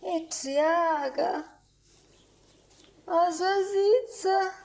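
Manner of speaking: high, slow cooing. Acted. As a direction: coo it like to a baby.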